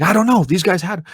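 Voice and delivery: high, desperate excuse; panicked denial. Retold or imitated. Father telling his wife the babysitters had her, high and frantic. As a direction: plead in a rising panic. Dreamer defends himself, panicking.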